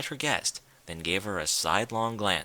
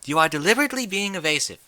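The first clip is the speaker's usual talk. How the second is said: fast, high and louder rebuke; Rarity scolding evasive Rainbow, fast and sharp; 7 semitones higher, 5 dB louder, faster than usual. A narrator's reading. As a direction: rattle off the accusation sharply.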